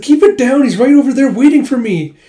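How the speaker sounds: high, loud flustered hushing. Retold or imitated. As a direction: hush her in a loud panic. Embarrassed friend hushing.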